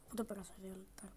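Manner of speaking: hushed muttered count; whisper. Real, not acted. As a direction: whisper to himself in hiding. Ψιθυρίζει κρυμμένος. (ψιθυριστά)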